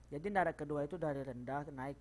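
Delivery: ordinary talk, nothing marked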